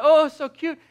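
high, loud gushing coo; hammed-up aww; cooing over a kitten. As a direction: melt over something adorable. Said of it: "you're just like".

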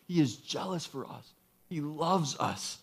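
soft, hushed and heartfelt; tender assurance. Real, not acted. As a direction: let it slow down into quiet sincerity. Preacher drops to tender assurance.

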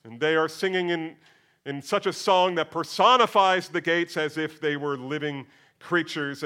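normal talking voice

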